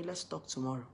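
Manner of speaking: very soft, low-key reply; talking without screaming. Retold or imitated. Boyfriend answers her shouting, talking without screaming. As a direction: calmly put it off, well under normal volume. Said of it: "taught me how to talk without screaming".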